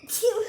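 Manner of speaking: loud real sneeze; sharp sudden achoo; sneezing. Real, not acted. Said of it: "I'm gonna sneeze again".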